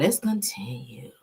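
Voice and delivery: slow, secretive whisper. Real, not acted. Moving the podcast on, in a slow, secret whisper. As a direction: hush it like a secret. Hushed aside to listeners.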